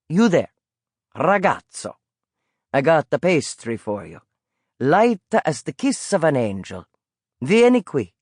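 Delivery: put-on old street vendor with an Italian lilt, calling out. Old vendor coaxing the boy toward his pastry cart, Italian accent. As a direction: coax the boy over like a friendly Italian peddler.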